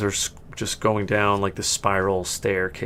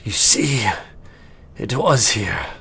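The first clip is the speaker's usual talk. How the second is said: awed elf; slower than usual. Acted. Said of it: "and the elf said".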